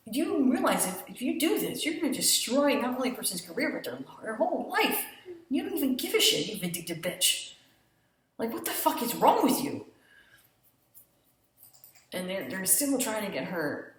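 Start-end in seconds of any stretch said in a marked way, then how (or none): 0.1-9.9 mock furious rant. [name] railing at Faye's vindictiveness, mock fury. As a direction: spit out the insults in a mock rage. Retold tirade at Faye.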